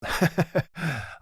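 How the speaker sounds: a short high scoffing laugh that falls off; scornful laugh. Acted. Mobster mocking the aunt's ex, scoffing laugh. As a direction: laugh him off as a loser.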